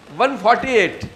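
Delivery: number announced loudly; calling out. Real, not acted. Teacher repeats the number to the group, calling out. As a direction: call the number out with force.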